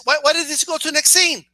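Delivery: usual speaking voice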